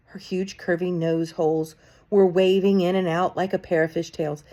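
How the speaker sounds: plain reading voice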